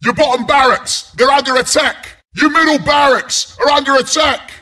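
high, exasperated warning calls; urgent alarm. Acted. Announcer to player ignoring barracks attack, urgent alarm. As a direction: badger the player urgently to defend.

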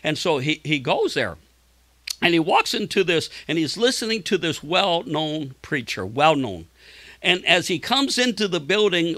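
ordinary talk, nothing marked

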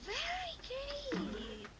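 high, slow, stretched-out cheer; enthusiastic praise. Real, not acted. Praising pupil's correct answer.

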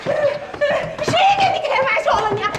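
slow angry shout. Acted. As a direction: throw the question back hard. Heated quarrel over gold.

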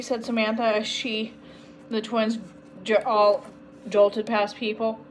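ordinary delivery, nothing marked

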